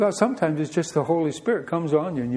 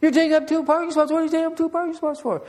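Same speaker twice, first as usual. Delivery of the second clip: high, shrill-free mock old lady yell, much higher; imitating an angry old lady, pitched way up; 12 semitones higher, faster than usual. Preacher mimics old lady yelling from car. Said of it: "the old lady sitting in the passenger seat is yelling at me".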